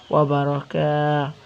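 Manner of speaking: slow বারাকা with the final aa held long; madd stretch. Shown as a demonstration. Teacher demonstrating madd to students, long drawn-out end on বারাকা. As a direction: stretch the last vowel of বারাকা. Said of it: "মদের ক্ষেত্রে টান হবে".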